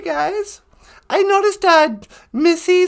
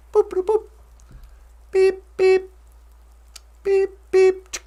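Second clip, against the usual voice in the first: short high spoken electronic beeps; imitated beeps; 4 semitones higher than usual. Pressing pretend buttons to go in, high little beeps. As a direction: beep like a push-button gadget.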